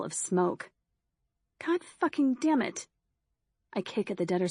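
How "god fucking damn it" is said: high, frustrated outburst; swearing at the car that left her behind; furious curse. Acted. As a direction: spit it out in raw frustration. Narrator curses the fleeing car.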